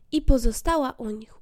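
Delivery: plain reading voice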